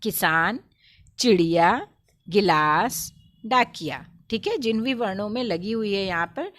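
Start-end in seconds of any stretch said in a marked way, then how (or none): 0.0-4.1 one word at a time, firmly, with pauses; emphatic teacher's model reading, pausing for pupils to repeat; read-along word drill. Shown as a demonstration. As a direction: lead the class through a word list. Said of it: "मेरे साथ साथ पढ़िए".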